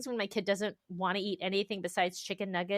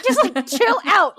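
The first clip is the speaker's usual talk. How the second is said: higher, louder slow chill out; telling herself off; 8 semitones higher, 11 dB louder than usual. Retold or imitated. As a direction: scold yourself lightly. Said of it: "And I'm like, [name]".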